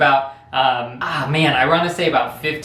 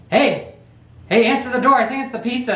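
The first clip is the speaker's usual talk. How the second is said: brother calling out; 8 semitones higher than usual. Retold or imitated. Brother calling from the background to the friend, raised voice. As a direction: shout to get the door answered. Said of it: "my brother in the background's like".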